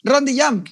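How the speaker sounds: a quick demo of "Rond de jam"; Mexican way. Shown as a demonstration. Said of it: "Like that".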